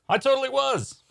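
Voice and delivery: high-pitched gleeful outburst; gleeful admission. Acted. Magician admitting he'd have sabered his rival, gleeful.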